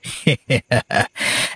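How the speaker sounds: soft unvoiced evil snicker; breathy villain snicker. Villain gloats after scaring the old man, snickering.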